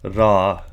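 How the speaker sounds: "ra" with the pitch rising on the a. Shown as a demonstration. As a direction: lift the tone at the end of "ra". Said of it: "increase in tone at the last A".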